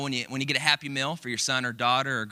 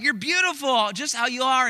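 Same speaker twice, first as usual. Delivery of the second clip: high, louder coaxing reassurance; loving reassurance; 11 semitones higher, 5 dB louder than usual. Retold or imitated. Parents telling their daughter she's beautiful, high and coaxing. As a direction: plead with her that she is lovely. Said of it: "But, but, like".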